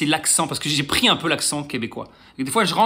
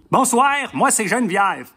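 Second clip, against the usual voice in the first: put-on Québécois accent; 4 semitones higher than usual. Blonde in a bar introduces herself, Québécois accent. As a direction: imitate a Québécoise, drawn out and higher. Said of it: "C'est vrai que l'accent est bizarre".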